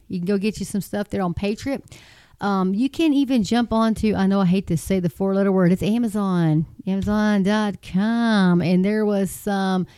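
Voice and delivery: ordinary talk, nothing marked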